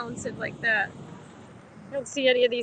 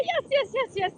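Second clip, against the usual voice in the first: excited squeal; 10 semitones higher than usual. Real, not acted. Reacting to cold wave.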